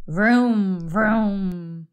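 drawn-out engine vrooms; car noise. A narrator's reading. Mister Watson dreaming of a fast car, long vrooms. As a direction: hum two long revs like a car. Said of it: "Mister Watson said".

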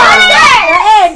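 loud shout. Real, not acted. Answer shouted.

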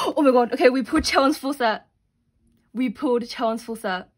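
real gasping, loud burst of joy; overjoyed. Real, not acted. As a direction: exclaim it loudly, thrilled at a lucky pull. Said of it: "I'm so happy right now".

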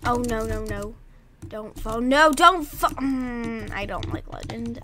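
ordinary talk

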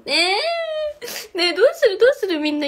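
high squeal then loud excited asking; flustered squeal. Real, not acted. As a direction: burst out giddy and embarrassed. Flustered by fan's praise.

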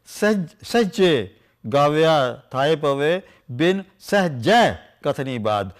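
slow, drawn-out emphatic recitation. Shown as a demonstration. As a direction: stretch each word of the verse. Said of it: "emphasis, like".

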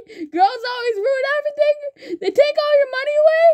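high, fast, laughing mock complaint; hammed-up whiny grumble; mock rant. Teasing the pink girl player, mock whine, high and fast.

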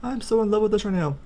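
high excited gushing; high-pitched fangirl gushing at cute Pokemon; gushing squeal. Real, not acted. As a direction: squeal over something adorable.

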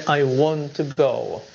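"I want to go" spoken slowly, each word separate; slow model sentence. Shown as a demonstration. Teacher modelling slow English sentence. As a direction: say it slowly for learners. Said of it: "Y la pronunciación".

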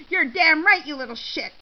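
loud, punched opening burst of indignation; angry yell. A narrator's reading. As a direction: explode at the accuser, vindicated. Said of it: "Shirley yelled".

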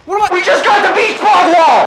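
excited screaming that strains the voice. Real, not acted. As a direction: scream it high and loud, slowed and stretched. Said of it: "That's not good for my voice".

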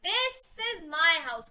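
This drawn out and loud; stress on This. Shown as a demonstration. Teacher showing which house is meant, stress on This. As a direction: lean hard and long on "This", slowly. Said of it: "मेरा this पे होगा".

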